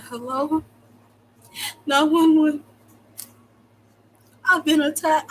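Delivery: high-pitched mock victim on the phone; exaggerated impression of his police call; mocking caller imitation. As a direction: put on a whiny emergency caller. Imitates him calling the police.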